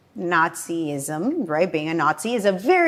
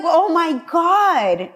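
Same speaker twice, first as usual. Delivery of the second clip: drawn-out disbelief; 9 semitones higher, 4 dB louder, much slower than usual. Retold or imitated. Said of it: "I'm like".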